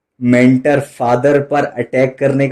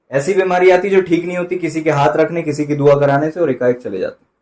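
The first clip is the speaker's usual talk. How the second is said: ordinary talk, nothing marked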